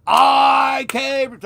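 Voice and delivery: high, loud excited shout of "I came"; retold shout. Fan shouting to [name] backstage, loud and high. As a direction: belt it out like a starstruck fan.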